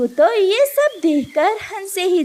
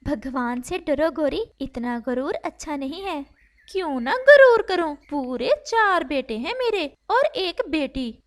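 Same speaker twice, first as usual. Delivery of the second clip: much softer, gentle storytelling voice; soft story narration; 3 semitones lower, 8 dB quieter than usual. A narrator's reading. A narrator softly tells a sad bird story, hushed. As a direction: read it softly like a bedtime tale.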